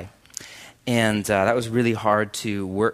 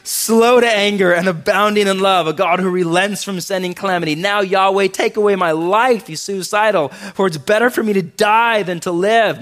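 loud, higher, whiny mock lament; melodramatic Jonah; 6 semitones higher, 6 dB louder than usual. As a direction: ham up a sulking prophet's complaint. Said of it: "Melodramatic, come on".

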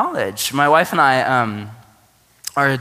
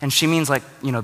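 ordinary talk

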